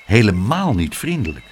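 plain reading voice

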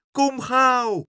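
high, loud cry for haste; urgent shout. A narrator's reading. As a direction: shout it high like an alarmed gull. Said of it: "schreeuwde Karel". (dringend schreeuwen, hoog)

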